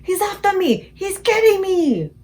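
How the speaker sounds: high, loud, slow panicked wail; terrified screaming. A narrator's reading. Giant wailing in his nightmare, high slow panic. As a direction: wail as if something grabs you. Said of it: "screamed the flesh lump eater".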